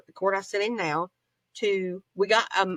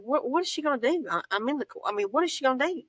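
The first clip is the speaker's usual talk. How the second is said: alarmed question; 6 semitones higher, faster than usual. Retold or imitated. Retold alarm as woman approaches.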